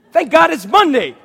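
high, loud laughing cheer; hammed-up happy Monday; mock cheerful. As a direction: burst out happily.

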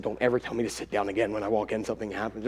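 low, quick, very soft grumble; griping. Retold or imitated. Dad complaining to family after forgiving, griping. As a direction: grumble it under your voice, fast. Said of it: "griped some more".

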